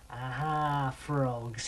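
lightly voiced low villain; triumphant Fat Tulip. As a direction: gloat quietly over cornered prey. Fat Tulip corners the frogs.

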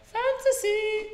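very high, slow falsetto on one word; squeaky echo. Pretend. Gamer echoing fantasy football in silly falsetto.

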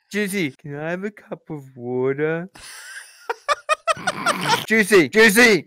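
high, repeated calling from bed; child calling out. Acted. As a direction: call for a parent at night. Child calling parent at night.

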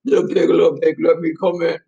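lightly voiced storybook wolf; a hint of a wolf demanding entry. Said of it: "I'm like".

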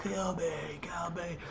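high, hushed begging; a man begging police to kill him; imitated desperate plea, soft and high; pleading. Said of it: "he told the cops to please kill him".

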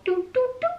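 high, light "doo doo doo"; playful little high-pitched doos; little doo-doo sounds. Shown as a demonstration. Showing how the charms line up, high playful doos.

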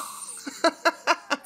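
high burst of giggles; amused cackle. Real, not acted. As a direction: crack up at your own joke. Laughing at family likeness.

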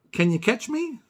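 lightly voiced high child calling down; child in tree. A hint of Koo calling down from the tree, high. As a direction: call playfully from up the tree.

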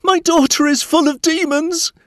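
high-pitched, loud cry for help; desperate shouting. A narrator's reading. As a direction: shout out a desperate plea for pity. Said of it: "came out shouting".

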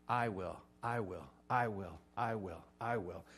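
I will, I will, with each I hit; stress on I. Shown as a demonstration. Pastor pointing the class to God's repeated I will, each I stressed. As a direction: tap out every I in the repeated I will. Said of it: "did you hear all the I's?".

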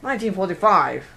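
hammed-up British accent of the nineteen-forties; old-time British accent. Shown as a demonstration. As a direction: imitate a jolly wartime British chap. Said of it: "like, a British, like, nineteen forty".

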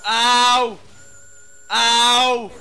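loud pained yelp. Real, not acted. Reacting to screechy mic noise.

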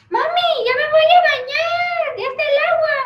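put-on high, loud child's call to mom; shouting child voice; kid shouting. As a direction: yell it like a little brother from the hallway. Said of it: "gritó".

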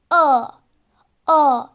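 "A" with the mouth open wide as for A but sounding toward O. Shown as a demonstration. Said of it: "ağzımızı A gibi açarken O kullanıyoruz".

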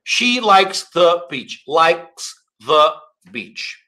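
likes and the with a small gap; slow, lightly separated words; subtle separation. Shown as a demonstration. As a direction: keep a slight break between likes and the. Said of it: "separadinha bem sutil".

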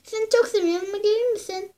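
normal talking voice

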